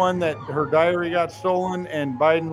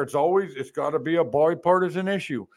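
ordinary talk

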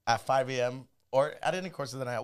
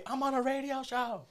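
hyped self-quote; 10 semitones higher, 4 dB quieter, slower than usual. Retold or imitated. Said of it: "I'm running around telling everybody".

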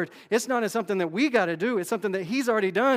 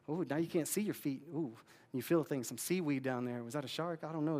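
much softer and lower, creeping unease; hushed nervous wading; 4 semitones lower, 8 dB quieter than usual. Pretend. Wading deeper into the ocean, hushed and uneasy. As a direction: drop low and soft as if sensing a shark. Imagined wading into deep water.

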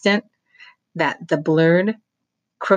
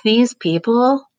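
put-on higher, slow, scornful girl; Beatrice scoffing; 8 semitones higher, 5 dB louder, slower than usual. A narrator's reading. Beatrice mocking the staged groundbreaking, slow and scornful. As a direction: drag it out with disdain.

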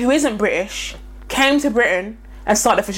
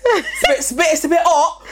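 laughing, much higher mock whimper about the heat; mock sweating Brit; 5 semitones higher than usual. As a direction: play a Brit overwhelmed by mild spice, giggling. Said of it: "'Cause that's not spice".

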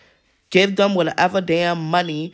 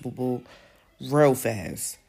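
normal talking voice

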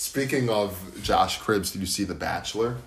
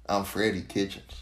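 lightly put-on Southern accent, softer; Freddie Kitchens; 5 dB quieter than usual. Co-host imitates a football coach, light Southern accent. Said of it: "That's how Freddie Kitchens sounds like?".

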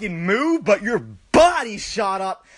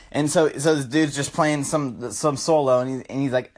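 normal talking voice

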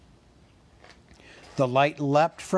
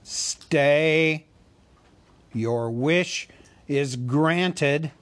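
commanding voice; 3 semitones higher, 4 dB louder, much slower than usual. A narrator's reading. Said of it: "said a voice".

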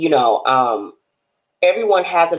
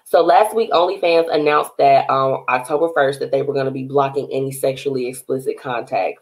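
usual speaking voice

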